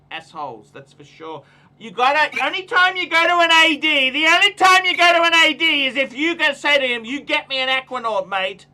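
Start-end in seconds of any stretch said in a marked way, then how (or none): 1.8-8.7 high, loud rant; louder and higher, hammering the point; shouting. Real, not acted. Shouted advice about dealers.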